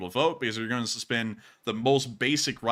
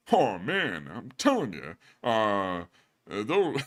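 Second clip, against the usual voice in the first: a light hint of the griping comic voice; lightly voiced no-respect comic; Rodney voice recalled; slower than usual. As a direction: slip briefly into the put-upon comedian. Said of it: "switch Rodney".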